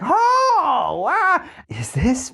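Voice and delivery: high, drawn-out wail of disbelief; hammed-up cartoon old man, aghast; safety guy voice. Safety guy reacting to backtalk, mock high-pitched disbelief. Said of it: "I do that voice at work".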